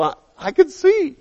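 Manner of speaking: high, thrilled cry of a man who can see; excited healed man. Acted. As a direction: burst out with joy. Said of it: "He starts".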